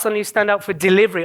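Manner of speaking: ordinary talk, nothing marked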